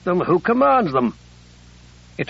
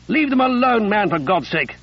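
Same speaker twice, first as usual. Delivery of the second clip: angry rebuke; 6 semitones higher than usual. Acted. Captain rebuking a sepoy for frightening prisoners, angry. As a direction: snap at the soldier to leave them be.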